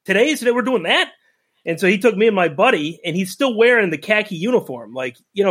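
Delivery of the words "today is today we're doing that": put-on higher, rising disbelief, like a thrilled kid; higher excited kid voice in disbelief; retold kid excitement. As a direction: relive the kid who can't believe it. Kid retelling thrill at dad's offer.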